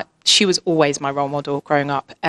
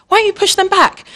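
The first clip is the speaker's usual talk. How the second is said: much higher, louder, incredulous push; exasperated urging; 5 dB louder than usual. Retold or imitated. Mother urging her bullied daughter to fight back, incredulous. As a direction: egg her on, half disbelieving.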